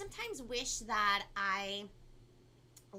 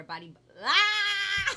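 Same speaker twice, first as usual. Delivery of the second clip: high, loud yelp as she trips over it; tongue-tied yelp; 12 semitones higher, 14 dB louder, slower than usual. Real, not acted. Fumbling the twister live for viewers, squealing. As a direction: squeal when the words fall apart.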